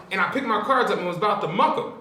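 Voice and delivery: ordinary talk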